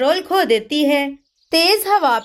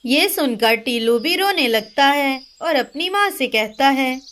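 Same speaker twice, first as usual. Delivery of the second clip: usual speaking voice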